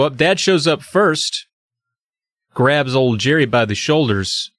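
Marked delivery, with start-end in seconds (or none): none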